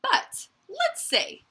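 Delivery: high, slow teasing lead-in; suspenseful lead-in. Acted. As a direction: draw them in before the surprise. Teasing build-up to surprise.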